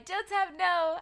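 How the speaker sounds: high, loud, pleading protest; dismayed cry. Real, not acted. Streamer begs Joe Tab not to cry, high loud protest.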